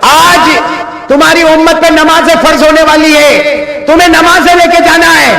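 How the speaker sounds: high, ringing proclamation; full-force preaching. Acted. Preacher declares the command of prayer to the faithful, high full-force preaching. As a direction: proclaim it to the whole congregation.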